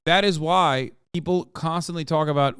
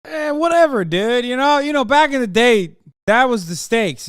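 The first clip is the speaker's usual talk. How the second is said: mock nonchalance; 7 semitones higher, 6 dB louder than usual. Mock old-timer dismissing nukes.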